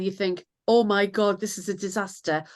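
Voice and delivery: ordinary delivery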